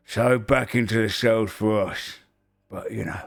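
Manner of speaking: unhurried ogre character voice; ogre telling tale. Acted. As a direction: recount the misadventure in an ogre voice, easing off at the end. Game master plays storytelling ogre.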